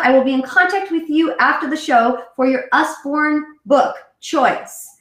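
ordinary talk, nothing marked

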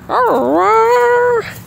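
high, loud arr-arr imitating a dog; playful mock dog howl; dog howl imitation. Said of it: "Lizzie went".

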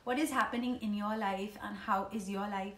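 normal talking voice

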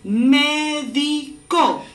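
"Médico" very slow, me-di-co; palabra por sílabas. Shown as a demonstration. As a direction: spell out "Médico" syllable by syllable. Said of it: "¿Qué palabra?". ("Médico" muy lento, mé-di-co)

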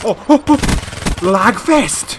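loud, high outburst; exasperated shout. Real, not acted. Player crying out as the game lags, exasperated shout. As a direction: yell out in gaming frustration.

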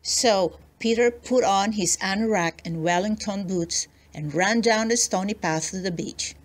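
ordinary delivery, nothing marked

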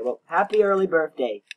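ordinary delivery

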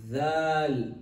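zal long and loud, tongue pushed out. Shown as a demonstration. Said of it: "Bring out your tongue".

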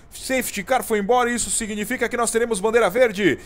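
rising live race call to a shouted finish; motorsport commentator. Real, not acted. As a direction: call the final lap at full excitement.

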